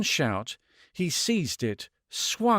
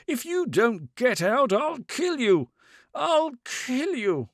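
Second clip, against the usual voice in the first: near scream; 10 semitones higher than usual. A narrator's reading. Man threatening the woman, pitched almost at a scream. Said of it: "pitched almost at a scream".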